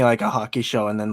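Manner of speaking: normal talking voice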